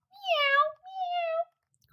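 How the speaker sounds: very high, soft mewing meow; a thin pleading mew; plaintive cat cry. A narrator's reading. Simpkin crying at the door, high and soft. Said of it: "cried Simpkin".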